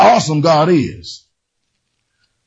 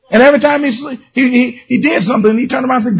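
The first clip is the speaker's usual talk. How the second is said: ordinary talk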